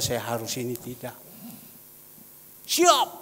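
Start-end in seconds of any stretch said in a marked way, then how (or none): 2.7-3.1 short, high, loud shout; sharp military-style acknowledgement; guard's shouted salute. Retold or imitated. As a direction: call out like a doorman to the boss. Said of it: "bagian penjaga pintu".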